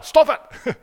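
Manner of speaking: mock yell at a sibling, high and loud; annoyed sibling. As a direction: snap loudly like a squabbling child. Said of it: "You probably say it to your siblings".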